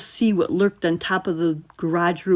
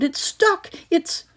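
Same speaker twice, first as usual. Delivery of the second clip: panicked shout; 12 semitones higher, slower than usual. Acted. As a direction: yell it in panic. Panic at the door.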